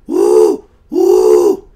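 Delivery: low, loud whooping woo; loud low hype hoot; party hoot. Real, not acted. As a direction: whoop it up for the crowd. Hyping the group trip.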